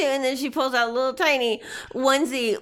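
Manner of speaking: usual speaking voice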